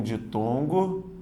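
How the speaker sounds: slow emphasis. Shown as a demonstration. Teacher stressing the term.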